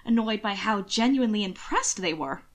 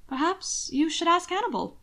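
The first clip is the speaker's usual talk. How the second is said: sly Will; 8 semitones higher than usual. A narrator's reading. Will slyly deflecting.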